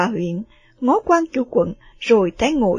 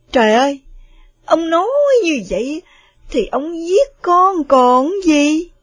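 alarmed plea; 6 semitones higher, 7 dB louder than usual. Acted. Mother protests husband's harsh words.

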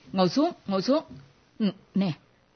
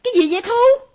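exasperated scolding; 7 semitones higher than usual. Acted. Mother scolds her daughter for joking, high exasperated scolding. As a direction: let the exasperation shoot up high.

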